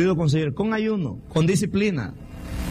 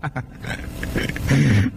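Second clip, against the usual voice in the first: trailing chuckle; 3 semitones lower than usual. Real, not acted. Preacher laughs at own story.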